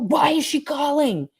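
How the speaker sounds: high, slow suspicious question; suspicious interrogator. Retold or imitated. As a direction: press her like a suspicious official. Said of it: "I was like".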